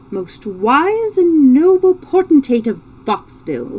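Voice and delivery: loud, high pompous proclamation. A narrator's reading. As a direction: address a king with swelling pomp. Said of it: "in a pompous voice".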